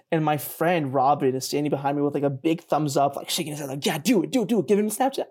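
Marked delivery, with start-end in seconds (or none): 3.8-5.3 rapid-fire imitation of a cheering buddy; eager egging-on. Friend urging the girl behind his back, eagerly. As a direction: urge on with rising excitement.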